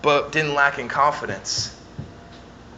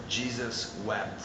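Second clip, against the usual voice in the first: quiet, slow and much softer; hushed solemn; 4 semitones lower, 12 dB quieter, slower than usual. Real, not acted. Pastor answering doubters, hushed and slow. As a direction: let it fall to a hush.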